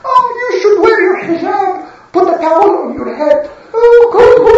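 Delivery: very high whiny mock-preacher voice; mocking imitation. Gang mimicking the prophet to the girls, very high whiny mockery. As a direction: whine it like a parody. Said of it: "He's going to say to the girls".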